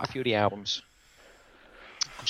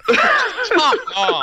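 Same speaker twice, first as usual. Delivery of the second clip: laughing; 7 dB louder than usual. Real, not acted. Quizmaster laughing at buzzer.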